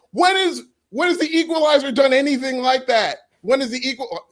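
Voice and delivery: rising high and fast in disbelief; excited. Real, not acted. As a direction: push it up, incredulous. Heated debate rebuttal, pitch jumps.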